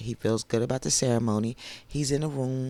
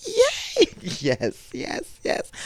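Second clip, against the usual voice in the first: high squealed cheer that breaks up; celebrating with lost voice; 4 dB louder, slower than usual. Real, not acted. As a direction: cheer excitedly on a voice not all there. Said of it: "My voice is still not all the way there".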